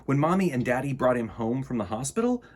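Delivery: ordinary delivery, nothing marked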